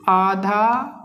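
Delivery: slow, word by word with long pauses; dictation. Shown as a demonstration. Teacher dictating a definition for students' notebooks. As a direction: dictate it so the class can write.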